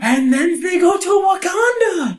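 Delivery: high, loud eureka cry; excited shout. Real, not acted. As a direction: shout it out in delight. Sudden plot-fixing idea.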